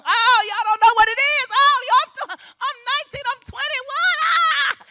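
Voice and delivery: excited youth. Retold or imitated. Speaker mimicking excited young graduates, high shout. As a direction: squeal like a thrilled twenty-year-old.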